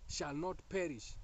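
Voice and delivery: ordinary talk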